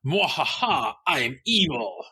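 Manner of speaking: hammy cartoon villain gloat; one-note evil villain. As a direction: ham it up like a cartoon baddie. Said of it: "a one-note".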